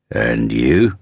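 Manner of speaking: big man's low questioning rumble; deep rumbling voice. A narrator's reading. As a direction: ask it deep, with a rising end. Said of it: "a deep voice rumbled from just behind them".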